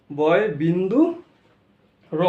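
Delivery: "বয় বিন্দু" rising, long pause, then "র"; corrected, separated name. Shown as a demonstration. Teacher repeating the correction, "বয় বিন্দু", pause, separate "র". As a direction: break "বয় বিন্দু র" before its last letter. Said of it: "বয় শূন্য র নয়".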